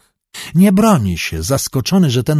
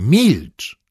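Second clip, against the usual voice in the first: put-on sharply accented, restrained cry to be silent; narrated cry of alarm, not a full shout; frightened cry. A narrator's reading. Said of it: "krzyczy do niego przerażony Jakubowski". (przerażony, powściągnięty okrzyk)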